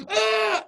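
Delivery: loud high imitated child's scream; sudden scream. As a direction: burst into a short piercing shriek. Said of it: "because she's screaming".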